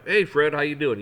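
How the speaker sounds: loud, friendly hello to a friend; hearty greeting. Shown as a demonstration. Greeting a friend named Fred, loud and friendly. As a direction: call out his name warmly. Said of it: "You acknowledge him".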